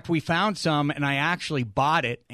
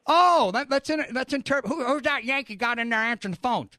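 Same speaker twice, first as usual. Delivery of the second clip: Southern host mocking the Yankee; 7 semitones higher than usual. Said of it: "southern draw".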